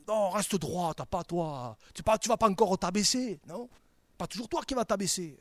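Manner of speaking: loud, pushy voice of temptation; devil's goading. Acted. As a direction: egg them on as the devil would. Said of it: "le diable, lui, nous dira toujours".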